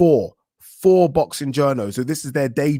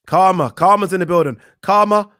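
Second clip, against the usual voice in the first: loud exasperated cry; 6 semitones higher, 6 dB louder than usual. Real, not acted. Reacting to bad news.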